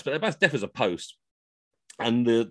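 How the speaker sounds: usual speaking voice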